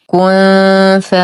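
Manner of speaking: "كن ف" recited slowly, noon held as a hum; full nasal ikhfa. Shown as a demonstration. Teacher models hidden noon for learners, long nasal "كن ف". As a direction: hide the noon of "كن" in a long light hum before ف. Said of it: "إخفاء حقيقي مع غنة كاملة مرققة".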